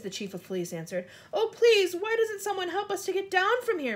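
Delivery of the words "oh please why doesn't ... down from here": high, pleading call. A narrator's reading. Miss Spider begging to get down, calling out. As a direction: plead loudly for help. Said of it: "Miss Spider called out".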